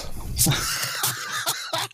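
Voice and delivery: real sneeze, breathy burst; sneezing. Real, not acted. (lautes Niesen)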